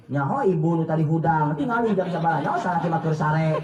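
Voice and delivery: normal talking voice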